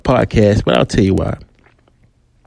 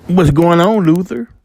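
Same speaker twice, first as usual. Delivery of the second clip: mock sarcastic cop greeting, pitched up; 7 semitones higher than usual. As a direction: taunt him with fake friendliness. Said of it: "they with their sarcasm".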